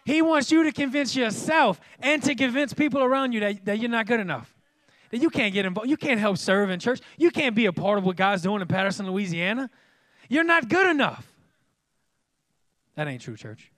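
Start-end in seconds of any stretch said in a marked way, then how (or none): 0.0-11.3 high, loud preaching; preaching at full force. Acted. Pastor warning the church about the enemy, full-force preaching. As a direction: press the lie home hard.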